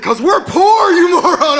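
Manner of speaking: loud, high-pitched acted scolding; exasperated mom. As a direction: play a mother snapping at her clueless kid. Mother scolds child in retold quarrel.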